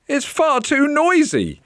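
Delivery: very high, loud complaint; frustrated outburst over the racket; exasperated mum. Acted. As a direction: cry out over the squawking bird. Mum exasperated by squawking interruptions.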